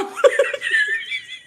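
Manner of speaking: very high squealing laugh; helpless laughter. Real, not acted. Host losing it while excusing the reaction, squealing laugh. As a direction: break down laughing mid-explanation. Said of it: "I'm sorry, I laugh".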